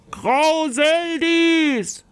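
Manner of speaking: long, slow, high and loud call of the name. Acted. The knight calls the giantess out, a loud slow call. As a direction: summon the giantess across the hall. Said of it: "rief sie".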